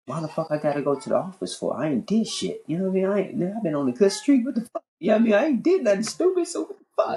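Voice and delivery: high-pitched, rising indignant grumbling; worked-up, high-voiced disbelief; indignant protest. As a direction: protest your innocence, pitch climbing as you go. Retells his indignant protest at summons.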